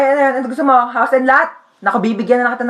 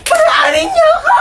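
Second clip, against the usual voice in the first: shouting. Acted. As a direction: bellow to get through. Doctor shouting to pass.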